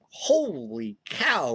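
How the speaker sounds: high, slow holy cow of amazement; amazed. Retold or imitated. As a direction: let the wonder stretch out slowly. Amazed at the dog backing off.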